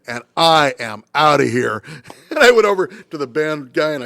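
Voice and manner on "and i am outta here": slow, louder, deliberate parting shot; defiant exit line. Retold or imitated. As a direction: drop it slowly and defiantly. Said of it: "I said".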